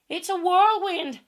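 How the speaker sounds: loud alarmed cry, pitched high; Aunt Em cries out in alarm. Acted. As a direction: shout the warning over the wind. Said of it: "cried Aunt Em".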